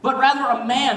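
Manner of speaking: ordinary talk, nothing marked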